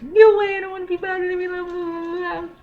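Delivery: put-on high, dismissive girl voice trailing into blah blah; mock annoyed daughter. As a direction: play the daughter waving dad away. Daughter brushing dad off, acted.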